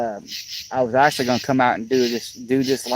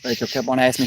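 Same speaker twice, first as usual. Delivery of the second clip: normal talking voice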